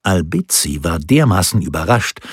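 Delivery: plain reading voice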